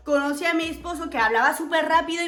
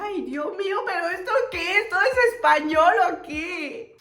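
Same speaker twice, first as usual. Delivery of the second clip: desconcierto exasperado; 5 semitones higher, 4 dB louder than usual. Retold or imitated. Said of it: "Y yo decía".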